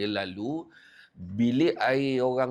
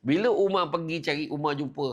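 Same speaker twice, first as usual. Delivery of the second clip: normal talking voice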